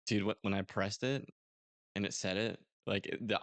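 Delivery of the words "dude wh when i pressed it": ordinary talk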